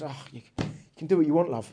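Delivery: lightly put-on Cockney; a hint of joking Cockney; Cockney accent. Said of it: "obviously spoke in a Cockney accent".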